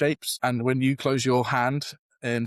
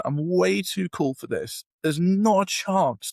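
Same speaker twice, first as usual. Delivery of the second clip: put-on high, raised retelling of a teen's rage at God; angry at God; 6 semitones higher, slower than usual. Teen abandoned by God rants at him, high and hurt. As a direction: hurl the complaint upward, wounded. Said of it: "I'm getting so angry".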